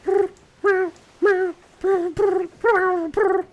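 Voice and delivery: high, loud trills and mews; cat calling out at night; cat chirps and meows. Retold or imitated. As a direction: imitate a cat trilling and meowing. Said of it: "You hear him do it".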